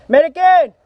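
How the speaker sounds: high shout across the field; loud callout. Real, not acted. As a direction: announce it loud to the team. Medic arriving shout.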